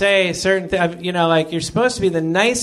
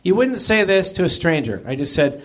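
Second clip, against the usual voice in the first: ordinary delivery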